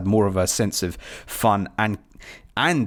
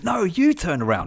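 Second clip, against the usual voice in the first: much higher pitch, peaking on "you"; audience talking back; 8 semitones higher than usual. Retold or imitated. Podcaster as yet another audience answering, high stressed "you". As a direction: stretch the retort up onto "you", then drop. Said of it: "an audience to that going".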